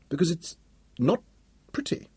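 each word set apart, soft; girl explaining why the equation is wrong, deliberate; measured emphasis. Acted. Paused emphatic child.

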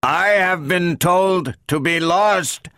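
slow, high, strained robot; harsh robot voice. A narrator's reading. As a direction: speak harshly, word by word. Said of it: "when he spoke harshly".

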